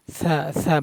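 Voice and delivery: "সা" with air blown out on the consonant; breathy release. Shown as a demonstration. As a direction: let air hiss through at the start of "সা". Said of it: "বাতাস বের করবেন, হামস করবেন". ("সা" বাতাস বের করে)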